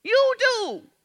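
loud, high, building sermon climax; preaching at full force. Acted. Preacher shouting at the congregation about choices, full force. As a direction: hammer each "You chose" home to the congregation.

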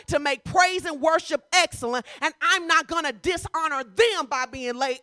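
loud, high, driving sermon delivery; full-force preaching. Real, not acted. Preacher urging congregation to be on time, full-force preaching.

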